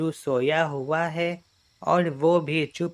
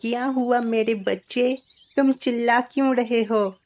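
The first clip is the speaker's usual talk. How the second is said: high-pitched put-on mother voice; anxious questioning, pitched well up; concerned mother; 8 semitones higher, 3 dB louder than usual. Mother asks her child what happened, concerned.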